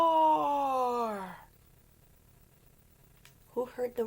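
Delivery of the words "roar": loud roaring lion shout. Pretend. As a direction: roar like a trapped lion. Lion trapped in net.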